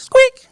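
sudden very high, loud squeak; imitated toy squeak. Father sitting on baby, imitated squeaky-toy squeal. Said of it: "sat on a squeaky toy".

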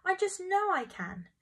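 high, insistent excitement; high-voiced grandmother bursting to try; eager grandma. A narrator's reading. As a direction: pitch leaps up with keen confidence.

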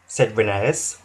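ordinary delivery

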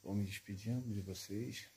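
soft, low tour guide murmur; hushed voice. Real, not acted. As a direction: keep it hushed, wary of the area. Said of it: "eu vou falar baixinho".

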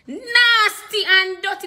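NASTY stretched long and high; drawn-out emphasis. Real, not acted. Preacher reminding flock of their sinful past, NASTY drawn out emphatically.

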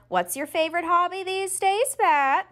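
high, sing-song dentist voice; a cheerful dentist quizzing a patient with a full mouth; chatty dentist. Retold or imitated.

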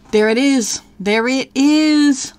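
calling out a find, thrilled; excited. Real, not acted. Player spots the bird she hunted, excited.

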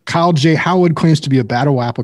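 mocking w-for-r, "Howard" as "Howood"; mimicked speech impediment. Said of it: "can't pronounce it".

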